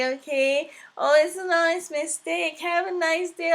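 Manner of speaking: high, breezy mock voice of a nosy stranger; mock rude woman. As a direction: parody her gushing excuses. Teller imitates the rude woman.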